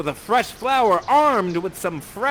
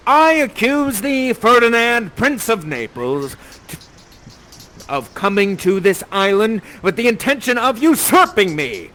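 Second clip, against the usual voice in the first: theatrical accusation; 3 semitones higher, 4 dB louder than usual. Acted. Prospero accuses Ferdinand of usurping him, theatrical denunciation.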